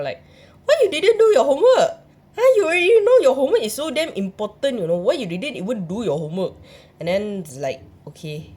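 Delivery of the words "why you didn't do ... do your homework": high, loud, fast scolding mum voice. Retold or imitated. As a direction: rattle off the scolding like an angry mum. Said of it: "I also get scolded from my mum".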